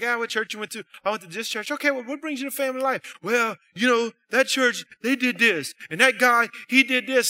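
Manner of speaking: light mock of a griping visitor; complaining visitor. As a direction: act out the newcomer griping. Dialogue with church visitors.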